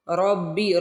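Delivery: रब्बी with a doubled bb; रब्बी, slow and joined. Shown as a demonstration. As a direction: lean into the bb of रब्बी. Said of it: "रा को बा से मिलाना है।".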